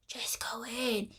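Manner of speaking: hushed whispered urging; a hushing man whispers her inside. Retold or imitated. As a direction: whisper it, pushing her along.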